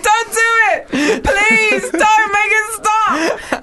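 high, slow, crying plea; desperate pleading. Retold or imitated. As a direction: beg in a high wailing voice. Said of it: "they're like".

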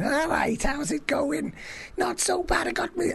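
high-pitched, chirpy Scouse greeting; Scouse accent. Shown as a demonstration. Comic showing friend his holiday voice, high Scouse accent. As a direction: do an over-the-top Scouse hello. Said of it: "I started talking in a Scouse accent".

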